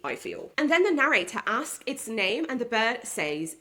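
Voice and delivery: ordinary delivery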